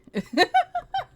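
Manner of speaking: very high squealing giggle; squealing laugh. Real, not acted. Streamer squealing with laughter at chat's confusion.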